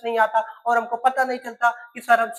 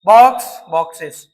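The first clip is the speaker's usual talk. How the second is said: loud demo pair; 3 semitones lower, 10 dB louder than usual. Shown as a demonstration. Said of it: "clear हुआ?".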